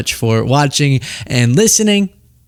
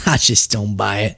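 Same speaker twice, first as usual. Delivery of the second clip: mock Southern accent; 5 semitones lower, 4 dB quieter than usual. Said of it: "just 'cause of his accent".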